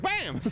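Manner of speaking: high mock "bam" exclamation; imitated bam. Retelling what he was like, high mock exclamation. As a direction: pop it out high as a sound effect. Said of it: "I walked to the Uber, like".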